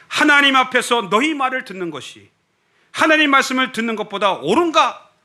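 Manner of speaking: high, ringing defiant declaration; apostles' bold reply. Acted. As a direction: answer the rulers boldly, high and forceful. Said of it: "베드로와 요한이 대답하여 가로되". (사도처럼 높고 담대하게 선포)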